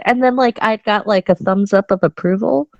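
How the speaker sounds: ordinary delivery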